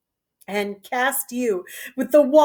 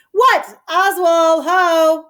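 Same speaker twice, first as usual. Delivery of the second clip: loud, much higher call; 6 semitones higher, 6 dB louder, slower than usual. Acted. Goneril summoning Oswald, calling out loudly. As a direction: shout for the servant across the hall.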